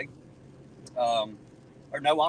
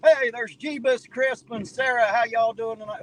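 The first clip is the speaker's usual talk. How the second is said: cheerful greeting; 7 semitones higher, 4 dB louder than usual. Real, not acted. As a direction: warmly greet arriving chatters. Greeting stream viewers.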